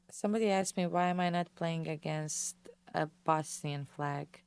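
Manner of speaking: normal talking voice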